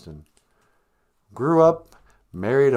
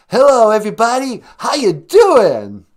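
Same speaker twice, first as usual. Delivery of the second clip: [name]; 12 semitones higher, 12 dB louder than usual. Retold or imitated. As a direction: greet the arena like a rock frontman. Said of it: "[name], ladies and gentlemen".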